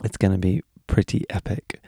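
ordinary delivery

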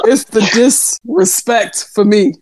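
slow, drawn-out mock offence; deliberate emphasis. Real, not acted. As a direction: space the words out for comic effect. Repeats line for comic emphasis.

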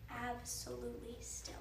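whispered stillness; hushed whisper. A narrator's reading. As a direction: breathe it out in a hush. Narration after the storm.

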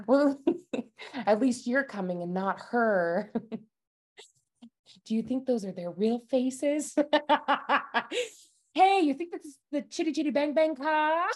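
high giggly Coolidge voice; wide-eyed and breathless; Jennifer Coolidge impression.

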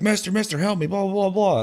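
high-pitched imitated plea; mock cry for help trailing into filler; roadside stranger. Stranger calling for help, retold.

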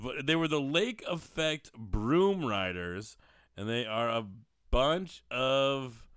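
ordinary talk, nothing marked

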